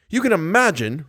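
stage manager lashing out, roaring. Acted. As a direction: start the tirade hot. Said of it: "roared the stage manager".

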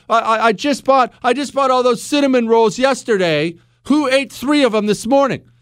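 high-pitched imitation of an indignant wife; accusing wife. Wife to husband over missing cinnamon rolls, mock accusing.

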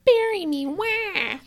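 put-on high wailing baby voice; ghost baby voice. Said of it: "what'd you say earlier?".

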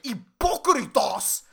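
"hipócritas" loud, slow and over-enunciated in Spanish; over-pronounced Spanish. Retold or imitated. Mimicking an angry student's Spanish, "hipócritas" drawn out and over-pronounced. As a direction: punch out "hipócritas", every syllable exaggerated. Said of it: "she was doing it in Spanish, so it sounded like".

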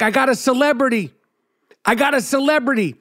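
guard over the walkie-talkie, mock excited; excited announcing. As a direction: announce him like a prize catch. Security guard announcing a celebrity.

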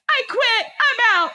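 high-pitched dramatic announcement; retold fed-up outburst. Wife quits the fight and leaves, retold exasperated cry. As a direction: re-enact storming out, pitch way up.

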